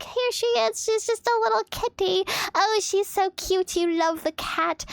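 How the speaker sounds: high, cooing voice showing off a kitty; cooing over kitty. Retold or imitated. Proudly presenting the kitten to Jaws, high and cooing. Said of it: "And I'm like".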